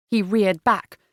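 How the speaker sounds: plain reading voice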